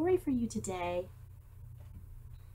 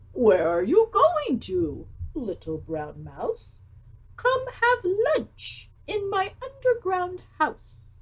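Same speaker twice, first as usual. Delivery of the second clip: a slow, higher sly fox voice; 9 semitones higher, slower than usual. Acted. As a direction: lure the mouse in like a sly fox. Fox invites the mouse.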